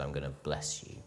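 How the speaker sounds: low, soft, understated promise; God's quiet promise. Acted. God speaking to one tiny man, quiet promise. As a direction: say it softly and low, letting "you" fall away. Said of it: "The God of the universe saw me and said".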